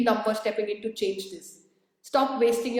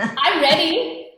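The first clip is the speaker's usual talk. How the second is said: eager reply; 6 semitones higher, 6 dB louder, slower than usual. Real, not acted. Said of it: "I'll be there when you're ready".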